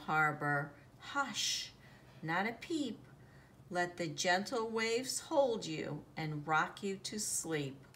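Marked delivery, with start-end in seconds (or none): none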